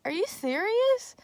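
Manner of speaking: can't believe what the cop just said; stunned are you serious; incredulous. Retold or imitated. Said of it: "And I was like".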